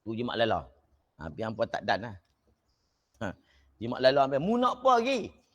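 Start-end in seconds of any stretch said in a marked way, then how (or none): 4.4-5.4 raised, slowed, irritated question; mock angry scolding. Old master to his pupil, mock angry scolding. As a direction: imitate a cross old master, drawn out. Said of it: "Marah dia".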